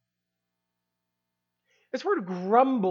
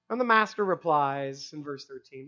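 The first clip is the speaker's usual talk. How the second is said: usual speaking voice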